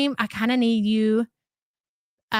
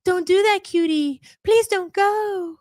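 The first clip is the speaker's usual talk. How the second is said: mock pleading husband; 7 semitones higher than usual. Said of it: "And normally it's the".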